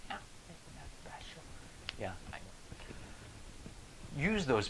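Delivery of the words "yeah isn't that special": higher, thinner whispered jab; high whispered sneer. Retold or imitated. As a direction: twist the knife with a breathy high voice. Coworker sneering again.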